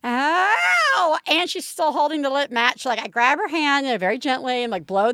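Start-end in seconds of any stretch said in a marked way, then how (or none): 0.0-1.2 put-on a high sharp child's ow; retold child's ow. Mother imitating her daughter's high burnt-finger ow. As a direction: yelp it like a little girl who just got burned. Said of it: "So she's like".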